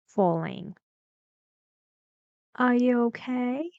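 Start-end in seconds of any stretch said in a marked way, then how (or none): 2.6-3.7 "Are you okay" pitched high, lifting at the end; a higher question with "okay" gliding up; rising intonation. Shown as a demonstration. Teacher contrasts rising with falling, "okay" going up.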